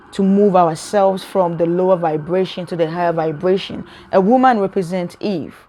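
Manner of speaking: ordinary talk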